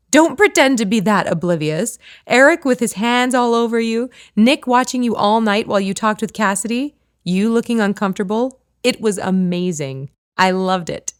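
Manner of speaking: bright, loud, delighted teasing; gleeful teasing. A narrator's reading. Friend teasing Kate about the party, gleeful and loud. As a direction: relish every juicy detail.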